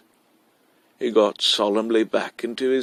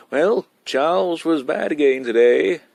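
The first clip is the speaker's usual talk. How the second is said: put-on gleeful boy; 6 semitones higher than usual. A narrator's reading.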